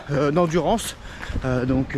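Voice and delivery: ordinary talk, nothing marked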